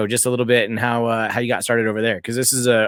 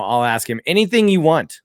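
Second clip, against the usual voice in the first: ordinary talk, nothing marked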